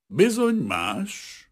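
slow, high, sighed agreement; sighing. A narrator's reading. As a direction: draw it out like a sigh. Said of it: "sóhajtott Vrana Bazil". (sóhajtva)

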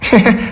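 short high squirrel giggle; amused chuckle. A narrator's reading. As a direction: chuckle lightly in character before speaking. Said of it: "A mókus most elnevette magát".

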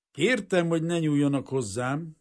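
smiling reproof. A narrator's reading. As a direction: object with a polite little smile. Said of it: "felelte ... egy kedves kis mosollyal". (mosolygós, udvarias rendreutasítás)

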